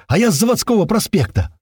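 high, gleeful shout; overjoyed. A narrator's reading. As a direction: announce it high, bursting with glee. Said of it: "Ещё больше развеселился Страйк".

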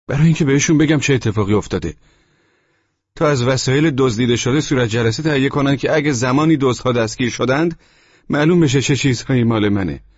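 high, fast insistent explaining; tenant's voice. Acted. Tenant explaining to the landlord why he needs the police. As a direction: rush through the obvious reasons.

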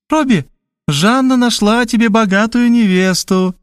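an excited mum with news; mother's voice. A narrator's reading. Mother on the phone announcing a rich bride, eagerly.

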